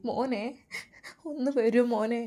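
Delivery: soft, plaintive plea; acted anguished appeal; distressed mother. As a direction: call weakly for help, voicing a worried mother. Injured son's mother calls for help.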